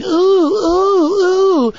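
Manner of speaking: very high, loud mocking "ooh"; loud, high, mocking swoon sounds. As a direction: coo like a thrilled admirer. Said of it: "they were all going".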